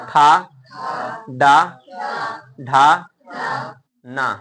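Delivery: ठा डा ढा said loud and apart, tongue curled back; demonstrating retroflex sounds. Shown as a demonstration. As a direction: bark out ठा, डा, ढा. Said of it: "अब बोलिए टा".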